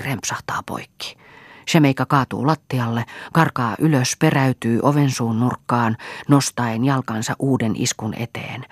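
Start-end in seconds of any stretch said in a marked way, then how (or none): none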